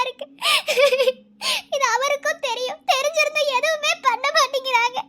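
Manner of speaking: high, tearful and helpless; tearful lament. Acted. Sister pours out her grief to her friend, tearful and high. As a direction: weep out the sister's guilt.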